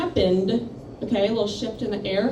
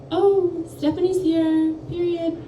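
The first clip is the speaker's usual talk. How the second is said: high, put-on teacher voice calling roll; mock substitute teacher; 8 semitones higher, slower than usual. Substitute teacher calling roll, high put-on voice. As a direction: lightly mimic the teacher taking roll. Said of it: "He's like".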